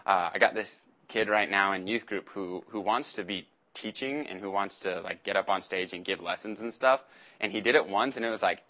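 ordinary talk

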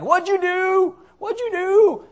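high, loud demanding voice; mock preacher demand. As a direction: bark the question at the newcomer. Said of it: "he'd be like".

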